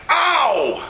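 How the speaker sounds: short high yelp of pain, lightly acted; a boy's pretend cry of pain; mock wail. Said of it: "he gave a wail out".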